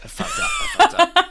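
very high, laughing mock admission; gleeful echo. Co-host repeating the traitor's admission, gleefully laughing. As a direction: parrot it through rising laughter.

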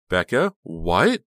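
frightened question. A narrator's reading. Honey frightened as Becca grabs the knife. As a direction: ask in sudden alarm. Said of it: "frightened cry of protest".

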